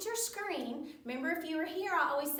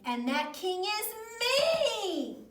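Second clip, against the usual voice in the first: rising high, loud, stretched-out declaration; grand storybook outburst on the last word; emphatic boast; 9 semitones higher, 6 dB louder, slower than usual. A narrator's reading. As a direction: proclaim yourself the one and only king. Carlos puts his foot down.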